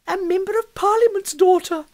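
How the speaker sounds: high, loud squeal of rapture; ecstatic exclamation. A narrator's reading. Amelia exclaims over the MP's daughter, ecstatic. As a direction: burst out in sheer ecstasy. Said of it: "ejaculated Amelia in an ecstatic tone".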